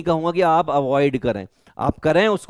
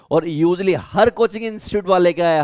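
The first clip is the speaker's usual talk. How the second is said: usual speaking voice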